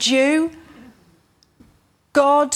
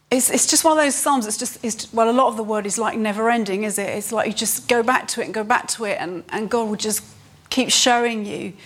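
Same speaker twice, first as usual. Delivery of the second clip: usual speaking voice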